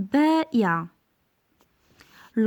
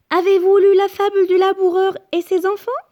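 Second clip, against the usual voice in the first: much higher and louder, rising question; question intonation; 7 semitones higher, 5 dB louder than usual. Shown as a demonstration. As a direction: read it as an eager question. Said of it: "c'est une phrase interrogative".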